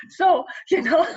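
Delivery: giggling through it; laughing. Real, not acted. Laughing at own video intro.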